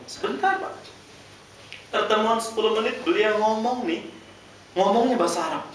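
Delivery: excited telling. Real, not acted. Excited retelling of meeting.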